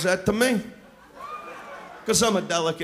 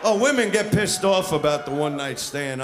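ordinary delivery

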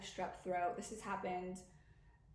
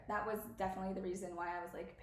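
normal talking voice

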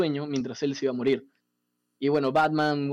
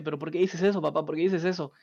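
ordinary talk, nothing marked

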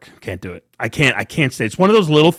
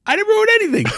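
1 mock indignant; much faster than usual. Real, not acted. Defensive denial, high.

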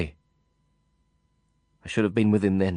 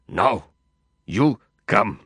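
Nazir's barely held anger; much slower than usual. A narrator's reading. Nazir gripping the narrator's arms, angry command. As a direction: force the words through held-back rage. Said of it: "The anger in him was so close to the surface".

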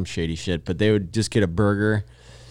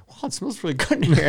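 boss voice; 8 semitones higher than usual. Retold or imitated.

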